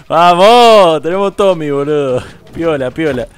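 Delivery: loud, high cheer; real excitement. Real, not acted. Cheering to his viewers about the new gun, loud. Celebrates getting a Tommy gun.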